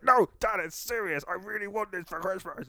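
put-on high, whiny kid begging his dad; pleading child self. Child begging dad for Christmas present, high whine. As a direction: plead like a seven-year-old wanting a present. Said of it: "But it's like".

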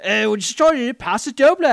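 loud, high, hammed-up Australian accent. Co-host redoes the dancer's line, in mock Australian accent. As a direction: belt out a broad mock Australian. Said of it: "I should say an Australian accent".

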